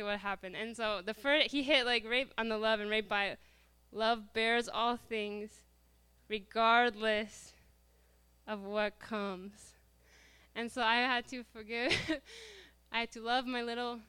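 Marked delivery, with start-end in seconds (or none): 3.9-9.7 very slow, spaced-out phrases; reading a sign aloud. Real, not acted. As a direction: read each phrase deliberately, pausing between.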